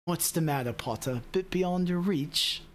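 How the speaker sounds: badly faked British accent, slowing at the end; amateur British schoolboy-villain impression; horrible British accent. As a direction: taunt in a hammy British accent. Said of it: "my horrible British accent".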